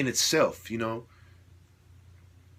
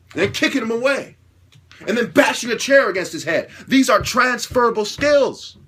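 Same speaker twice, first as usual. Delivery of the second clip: heated rant; 6 semitones higher, 4 dB louder than usual. Real, not acted. Heated rattling off of fighting moves.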